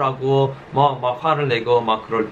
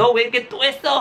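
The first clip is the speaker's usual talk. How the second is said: mock scolding; 11 semitones higher than usual. Parent scolding child, imitated.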